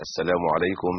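ordinary talk, nothing marked

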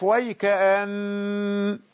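ويكأن slowed with the nasal نّ held long; nasal ghunna held. Shown as a demonstration. Teacher showing students the held ghunna in ويكأن. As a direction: stretch the nasal in ويكأن and stop on it. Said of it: "ولاحظ الغنة".

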